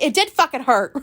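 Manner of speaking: laughing while admitting it hurt; laughing admission. Retold or imitated. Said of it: "And I was like".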